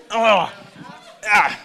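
normal talking voice

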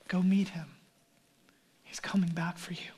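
softly whispered and quick; gentle whisper. Acted. Angel reassuring frightened disciples, fast soft whisper. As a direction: hush it like comforting someone scared.

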